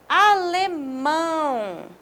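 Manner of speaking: "Alemão" very slowly with a long nasal ão; nasal ão demo. Shown as a demonstration. Teacher slowly showing the nasal sound to students. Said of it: "Percebem que ele dá um som nasal?".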